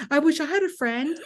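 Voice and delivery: put-on high whining kid wish; whiny wish. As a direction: mope like a sulking child.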